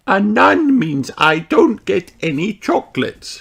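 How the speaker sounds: high, lightly put-on granny voice; Granny Pig voice. Granny explains the dreidel letter, high voice. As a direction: play the grandmother explaining the rules. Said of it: "Granny Pig explains".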